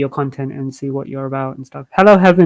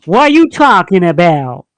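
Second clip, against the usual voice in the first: put-on Texas accent; 5 semitones higher, 6 dB louder than usual. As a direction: play a Texas sheriff. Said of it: "now let's hear your Texas accent".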